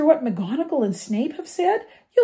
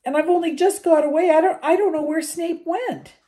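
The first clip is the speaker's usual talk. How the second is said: upset, higher and louder, rushing at the end; wailing; 9 semitones higher, 8 dB louder than usual. A narrator's reading. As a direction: pour out the bad news in distress. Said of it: "she wailed".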